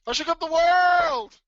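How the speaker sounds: high, loud boastful shout, drawn out; Muhammad Ali. Acted. Trader quoting the champ to his audience, triumphant boxer's shout. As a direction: crow it like a champ after a title fight. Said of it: "if you remember Muhammad Ali".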